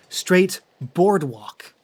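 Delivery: usual speaking voice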